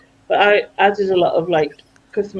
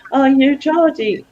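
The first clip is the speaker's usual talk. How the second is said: slow, higher-pitched mimicked question; drawn-out mock curiosity; curious caller; 6 semitones higher, much slower than usual. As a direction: imitate a caller guessing at the accent. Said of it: "most of the time you'd go".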